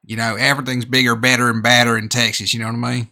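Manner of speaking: hammed-up American accent, Texan twang; mock Texas accent for comedy; put-on Texas accent. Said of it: "I'd be doing the American accent".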